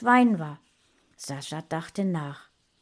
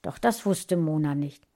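usual speaking voice

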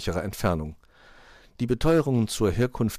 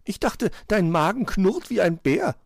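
high, louder puzzled bird; high wondering magpie voice; surprised magpie; 12 semitones higher, 5 dB louder than usual. A narrator's reading. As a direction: challenge him in astonishment. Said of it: "war Frau Elster verwundert".

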